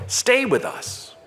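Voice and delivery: pleading. Acted. Disciples urge Jesus to stay.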